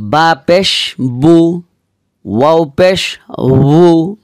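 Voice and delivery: বা পেশ বু drawn very slowly; slow demo of বু and উ. Shown as a demonstration. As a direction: stretch each syllable for students. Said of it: "একেবারে কাছাকাছি উচ্চারণ".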